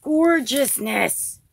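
booming, slow gorgeousness; loud delight. Real, not acted. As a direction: savor each syllable out loud in delight. Same word then said loud.

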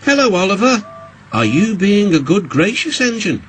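teasing. A narrator's reading. Duck surveying the crashed Oliver, teasing. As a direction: needle him with a lifted, arch question.

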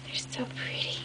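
breathless whisper of admiration; awed whisper. Real, not acted. Admiring the butterfly albums, hushed whisper. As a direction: whisper it in wonder.

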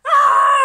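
very high, loud scream of laughter; shrieking laugh. A narrator's reading. The animals laughing at the wobbegong, shrieking laugh. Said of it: "laughed all the other animals".

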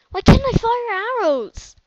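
annoyed at lag; frustrated yell. Real, not acted. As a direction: shout at the broken game.

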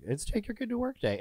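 high-pitched singsong mimicry; mock cheery announcement. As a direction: chirp it like an innocent excuse. Mimics dad's cheery cover story.

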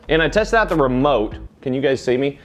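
ordinary delivery

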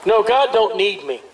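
ordinary delivery

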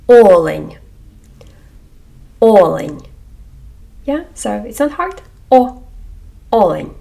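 олень modelled with stress on the first о; careful Ukrainian word. Shown as a demonstration. Teacher models олень for learners, careful and clear. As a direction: repeat олень clearly, lips rounded on о. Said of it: "Або у слові".

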